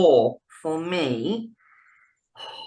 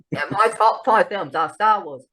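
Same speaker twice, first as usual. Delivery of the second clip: higher-pitched put-on film snob; mock a pompous fan listing favourites; snobby film-fan voice; 6 semitones higher than usual. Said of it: "Don't do it in that voice".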